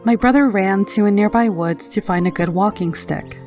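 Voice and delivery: ordinary delivery, nothing marked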